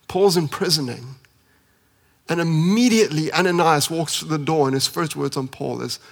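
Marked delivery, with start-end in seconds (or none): none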